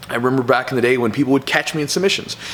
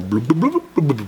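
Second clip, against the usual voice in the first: burble like someone sinking and surfacing; imitated bobbing underwater; 3 semitones higher than usual. Kid bobbing in the water, comic drowning noises. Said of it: "I'm going down and up".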